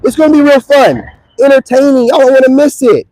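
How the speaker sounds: usual speaking voice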